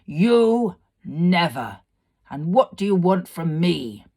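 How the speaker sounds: loud, scornful demon voice; mocking fire fiend. A narrator's reading. Fire fiend scoffing at the girl, loud and scornful. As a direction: sneer it down at the girl. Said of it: "He laughed a great laugh".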